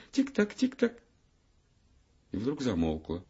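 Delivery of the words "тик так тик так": high, light tick-tock patter; little feet ticking down the stairs; ticking chant. A narrator's reading. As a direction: tap it out like tiny shoes on stairs. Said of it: "раздалось под сводами".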